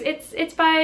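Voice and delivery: high, hesitant mock voice of the woman; imitating the stranger. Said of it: "she goes".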